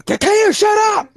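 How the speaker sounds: high, loud shouted outburst; exasperated yell. Acted. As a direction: break off mid-introduction and shout at the musician. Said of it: "he yells at you to shut up".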